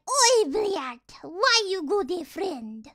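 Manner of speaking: loud mock-Russian scolding, falling off at the end; hammed-up exasperated Russian gamer. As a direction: scold a teammate as an imitated Russian player. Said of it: "And they're like".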